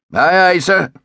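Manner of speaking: high, called-back naval reply; crisp acknowledgement. A narrator's reading. Officer answering the captain's order, calling back.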